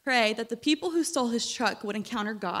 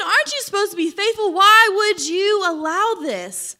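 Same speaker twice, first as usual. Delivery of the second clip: higher, louder, anguished questioning; anguished plea; 6 semitones higher, 6 dB louder than usual. Retold or imitated. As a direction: rail at God, heartbroken. Voicing listeners' complaints to God.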